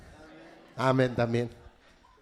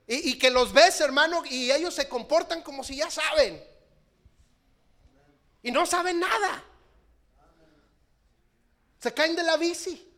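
higher, louder, driving the point home; fervent preaching shout; 9 semitones higher, 8 dB louder than usual. Real, not acted. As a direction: preach it out at full force.

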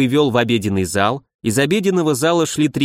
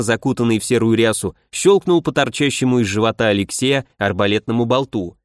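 ordinary delivery, nothing marked

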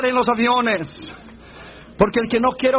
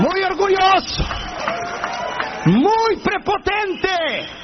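high, drawn-out complaint to God; acted accusing cry; 8 semitones higher, much slower than usual. Preacher denouncing the proud passenger to God, high wail. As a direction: call it upward, stretching the words.

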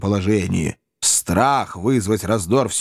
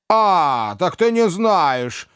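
put-on old Wardle; 8 semitones higher, much slower than usual. A narrator's reading. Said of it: "сказал мистер Уордль".

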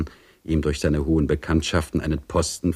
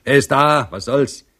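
grumpy shout; 8 semitones higher than usual. A narrator's reading. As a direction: bark it at the knock. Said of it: "rief er mürrisch". (mürrisch und laut rufen)

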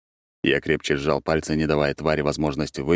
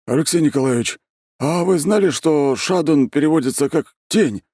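Grigory's voice; 11 semitones higher, 4 dB louder than usual. A narrator's reading.

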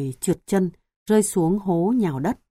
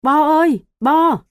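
loud, high call, growing worried; anxious calling; 9 semitones higher, 8 dB louder than usual. A narrator's reading. Father running along the bank calling his lost son, worried shout. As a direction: call out, starting to panic. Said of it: "rồi chạy xuống gọi".

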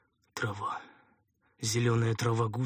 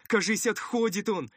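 high-pitched anxious cry; worried underling, voice pitched high; alarmed helper; 11 semitones higher, 4 dB louder than usual. Acted. Helper fears patient is dying.

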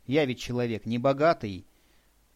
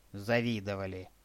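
bitter; 5 semitones lower, 5 dB quieter than usual. A narrator's reading. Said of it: "с горечью воскликнул мой спутник".

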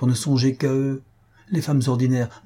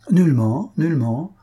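emphatic denial; 5 semitones higher, 4 dB louder than usual. A narrator's reading. Count reassures eagerly.

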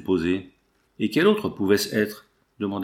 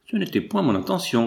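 higher, sugary suitor's voice, lightly done; fluting voice; 7 semitones higher than usual. A narrator's reading. Morin answers Coralie in his most fluting voice. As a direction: pipe it sweetly like a fawning suitor. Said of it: "de sa voix la plus flûtée".

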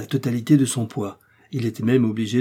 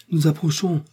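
higher-pitched cry of warning; 6 semitones higher than usual. A narrator's reading. Joe warns the crew of the looming mountain, crying out. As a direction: call out the approaching danger. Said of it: "s'écria Joe".

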